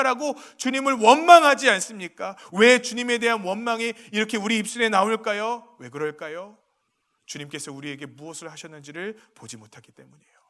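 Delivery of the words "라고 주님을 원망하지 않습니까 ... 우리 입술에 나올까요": loud, high, impassioned sermon delivery; rising fervent pulpit voice; full-force preaching. Acted. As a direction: preach it out to the whole hall.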